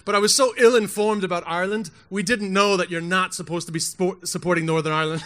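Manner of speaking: usual speaking voice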